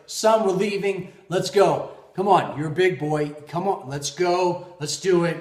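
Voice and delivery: loud, insistent parental order; exasperated, punchy come-on; impatient dad. Retold or imitated. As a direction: push the kid out the door, firm and loud. Impatient dad orders crying son.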